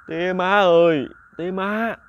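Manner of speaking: lightly odd, drawn-out call to the parents; a hint of a possessed man calling his parents; strange possessed voice. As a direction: call out to mother and father in an eerie, unfamiliar voice. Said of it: "kêu cái giọng lớ lớ".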